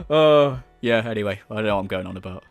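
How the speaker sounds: ordinary talk, nothing marked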